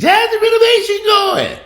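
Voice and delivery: very high, slow, prissy falsetto; mock rich lady. Rich folks at the beach asking about renovation, prissy falsetto. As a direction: lampoon a rich neighbour calling from the beach.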